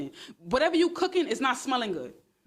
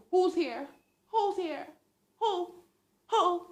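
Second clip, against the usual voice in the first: mock searching; 7 semitones higher, slower than usual.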